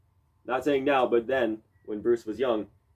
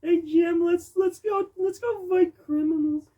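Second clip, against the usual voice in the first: much higher cartoon-hero voice, eager and loud; goofy cartoon cop; 6 dB louder than usual. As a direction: play an overeager cartoon cop pitching a crime fight. Joking voice of a cop character.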